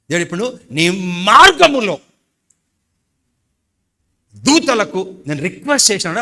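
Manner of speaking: loud, high, fiery declaration; preaching at full force. Acted. Pastor insists God never promised early death, loud preaching. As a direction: thunder it to the church. Preacher proclaiming angels' charge.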